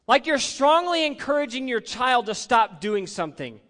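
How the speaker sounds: usual speaking voice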